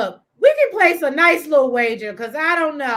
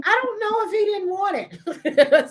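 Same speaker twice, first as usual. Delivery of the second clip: high, drawn-out, laughing words; helpless high-pitched amusement; 8 semitones higher, slower than usual. Real, not acted. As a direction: speak slowly through real laughter. Cracking up at a comment.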